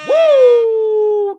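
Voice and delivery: loud high whoop to kick off; excited whoop. Real, not acted. Host opening the show for viewers, loud excited whoop. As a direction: whoop like you just got rich.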